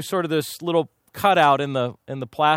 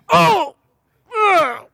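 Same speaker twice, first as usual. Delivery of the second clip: sudden high, loud yelp of pain; 8 dB louder than usual. Retold or imitated. Preacher retelling the blow to his sore side, yelping. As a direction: cry out as if struck on a fresh bruise. Child hits his bruise.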